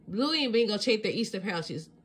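ordinary delivery

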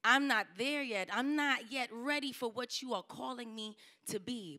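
usual speaking voice

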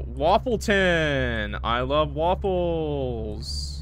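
hugely drawn-out, swooping cheer; mock enthusiasm. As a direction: stretch every word in silly delight. Mock cheer at town's name.

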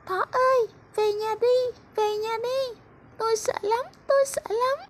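very high, pleading butterfly voice; tiny butterfly begging to go home in the storm; frightened little butterfly. Acted. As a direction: squeak it out, scared of the thunder.

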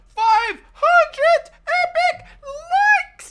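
high, drawn-out squeal of joy; excited squeal. Real, not acted. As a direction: shriek it out with delight, laughing. Celebrating likes milestone.

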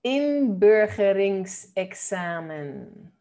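inburgeringsexamen, drawn out slowly, Dutch rounded vowel in "bur"; model pronunciation. Shown as a demonstration. Teacher showing learners how "inburgeringsexamen" sounds, slow and careful. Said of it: "cómo suena esto".

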